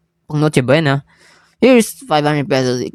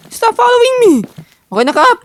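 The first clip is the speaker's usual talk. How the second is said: panicked yell. Real, not acted. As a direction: yell it like you're being chased. Chased by the captain in game.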